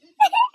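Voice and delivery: high loud laugh; sudden bright laugh out loud; burst of laughter. Real, not acted. Laughing at own joke.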